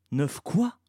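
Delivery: wary question with a rising end; circumspect, puzzled. A narrator's reading. Two children question an unknown name, circumspect. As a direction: query it in puzzled surprise. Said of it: "dirent-ils tous deux circonspects".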